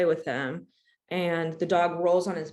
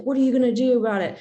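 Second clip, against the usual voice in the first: fast, louder, taunting challenge; mock aggressive challenge; 5 semitones higher, 8 dB louder, faster than usual. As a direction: throw it at her like a threat. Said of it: "he's like".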